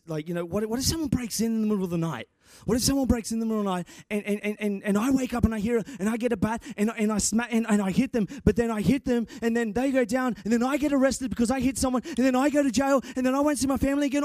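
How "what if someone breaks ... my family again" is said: rising, fast, breathless worry spiral; panicked rush. Pretend. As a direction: rattle it off faster and faster in mock panic. Spiralling worst-case panic fantasy.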